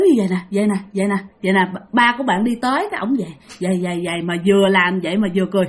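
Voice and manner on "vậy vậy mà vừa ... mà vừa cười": ordinary delivery, nothing marked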